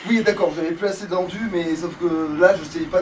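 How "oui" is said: high laughing curse; laughing exasperation. Real, not acted. Player laughing at his own blunder in the game, high laughing curse. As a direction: laugh it out at yourself.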